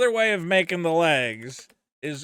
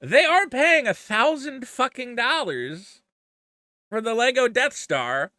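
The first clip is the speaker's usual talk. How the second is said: mock reasoning; 6 semitones higher, 3 dB louder than usual. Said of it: "part of me goes".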